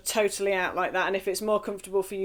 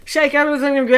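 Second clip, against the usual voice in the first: energetic call; 5 semitones higher, 9 dB louder, faster than usual. Real, not acted. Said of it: "warm-up cue".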